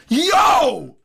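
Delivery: hyped streamer yelling at a donation; hype shout. Real, not acted. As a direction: blast it out at the chat. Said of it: "Blowing my voice out".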